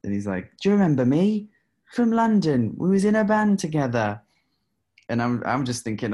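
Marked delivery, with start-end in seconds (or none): 0.6-4.2 loud, high-pitched mock British caller; exaggerated British accent at full volume. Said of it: "this weird, like, British accent".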